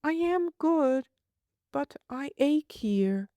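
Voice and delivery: put-on high, plaintive child voice; a small girl longing for home; homesick little girl. Child voice, aching.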